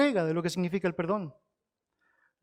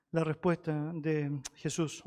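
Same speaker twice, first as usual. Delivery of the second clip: ordinary talk, nothing marked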